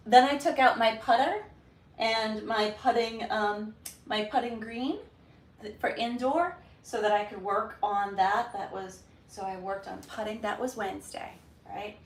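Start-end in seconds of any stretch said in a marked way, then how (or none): none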